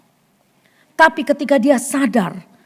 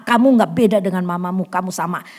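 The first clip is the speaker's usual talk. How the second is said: ordinary talk, nothing marked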